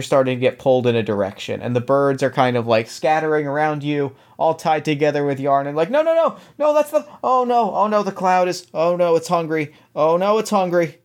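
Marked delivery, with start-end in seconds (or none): 5.9-11.0 high squeaky panicked voice; bright squeaky sing-song welcome. Acted. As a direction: squeak out a frantic oh no. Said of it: "That's not my character voice".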